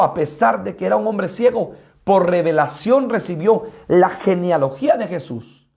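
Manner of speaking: ordinary talk, nothing marked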